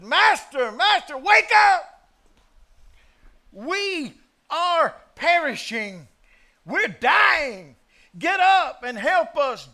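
high, loud panicked scream; frantic, desperate cries, slowed and loud; terrified screaming. Acted. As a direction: scream for your life as the boat sinks. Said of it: "Did you hear him screaming?".

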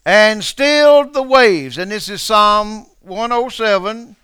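preacher thundering at the congregation; full-force preaching. Real, not acted. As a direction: drive the point home.

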